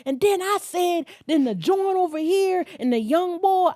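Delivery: high, loud retelling of an excitable friend; imitated yelling. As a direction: mimic a friend who yells her stories. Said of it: "she was always yelling".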